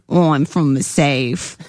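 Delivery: low, drawn-out southern British accent. Shown as a demonstration. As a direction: attempt a slow South of England accent. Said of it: "different types of British accent".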